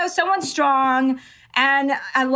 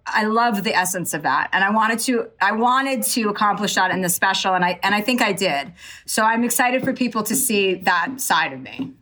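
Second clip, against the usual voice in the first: ordinary delivery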